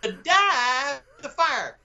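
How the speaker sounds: put-on grand theatrical verse in a Southern drawl accent; Southern-accented Shakespeare. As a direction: declaim Ariel like a Southern boy from Hattiesburg. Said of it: "Your accent".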